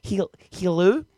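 Kiwi "hello", vowels shifted to "hillu"; New Zealand accent. Shown as a demonstration. Host switching to a Kiwi friend, attempted New Zealand accent. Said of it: "talk to my Kiwi friend now".

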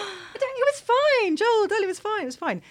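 high airy sing-song telling him it's fine; dazed reassurance. Retold or imitated. Drugged wife soothing her panicked husband, high airy reassurance. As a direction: float it out, drugged and carefree. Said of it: "I was like".

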